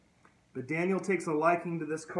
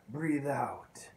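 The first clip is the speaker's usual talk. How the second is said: whispered exhale. Retold or imitated. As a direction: breathe out breathed as a whisper. Breathing instruction.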